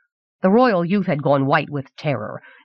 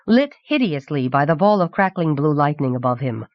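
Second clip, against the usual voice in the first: usual speaking voice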